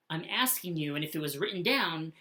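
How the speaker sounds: ordinary talk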